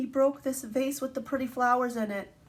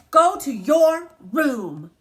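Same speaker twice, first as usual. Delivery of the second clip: slow, loud motherly order, every word spaced; stern mom; 3 semitones higher, 10 dB louder, much slower than usual. Acted. Teacher reading to class as angry mom, slow loud order. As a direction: send him off to his room, firm and loud.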